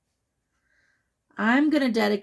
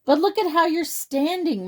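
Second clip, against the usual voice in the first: higher, louder chiding; lightly voiced bird; 8 semitones higher, 4 dB louder than usual. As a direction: point it out, bird voice. Marabou points out posture.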